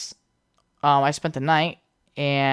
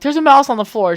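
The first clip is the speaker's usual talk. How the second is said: panicked; 10 semitones higher, 5 dB louder than usual. Retold or imitated. Panicked about the mouse.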